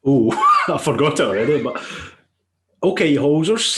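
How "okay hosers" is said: loud, high cowboy-ish attempt at Canadian; failed Canadian accent. Scottish host trying a Canadian line, sounding Texan. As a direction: overshoot into Texan. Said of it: "Texas, isn't it? Like a cowboy?".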